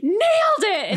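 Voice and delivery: high, loud, drawn-out triumphant cry; excited. Retold or imitated. Podcaster acting out Phoebe's sitcom scene, excited. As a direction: crow it like an excited TV extra. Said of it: "And she's excited".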